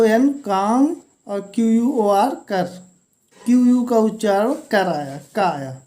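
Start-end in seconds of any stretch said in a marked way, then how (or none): none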